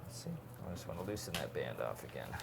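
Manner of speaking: ordinary delivery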